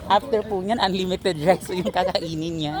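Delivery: ordinary talk, nothing marked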